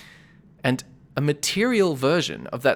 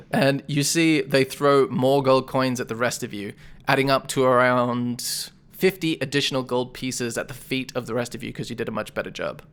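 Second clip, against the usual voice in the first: ordinary delivery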